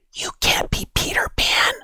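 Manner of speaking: a hushed, breathy whisper to a classmate. Acted. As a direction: lean in and whisper it, secretive. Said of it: "whispered Natalie".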